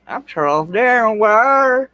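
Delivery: high, drawn-out name; showy self-announcement. Pretend. Host boasting he met a challenge easily, showy self-announcement. Boasts after meeting a dare.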